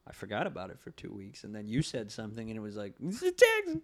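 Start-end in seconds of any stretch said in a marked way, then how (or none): 3.0-3.8 very high squeaky alarmed cry; mock alarm. Remembering taxes are due, a squeaky mock panic. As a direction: yelp it in sudden panic. Sudden realization of tax deadline.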